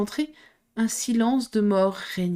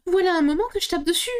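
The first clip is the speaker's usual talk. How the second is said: impatient; 10 semitones higher, faster than usual. Acted. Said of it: "impatientée".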